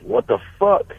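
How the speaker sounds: shocked. Retold or imitated. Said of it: "I was like".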